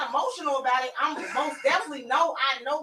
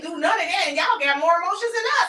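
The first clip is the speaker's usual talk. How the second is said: heated retort; 4 semitones higher, 7 dB louder, faster than usual. Real, not acted. Arguing back at the men.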